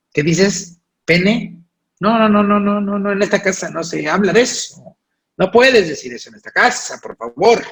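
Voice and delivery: high, loud scolding mother, horrified; mock outraged mother forbidding the word; scandalised parent. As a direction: shriek no no no like a shocked mom.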